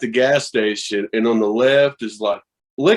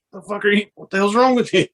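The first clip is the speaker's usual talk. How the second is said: higher, hissed disbelief at the clerk; mock alarmed scolding; 7 semitones higher, faster than usual. Retelling shock at a legal weed shop, mock alarm. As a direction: act scandalised that he's offering it openly.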